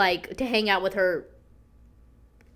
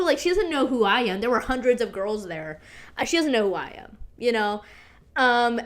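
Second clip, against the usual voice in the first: normal talking voice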